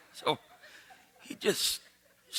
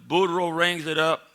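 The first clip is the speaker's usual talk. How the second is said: usual speaking voice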